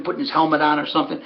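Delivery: ordinary delivery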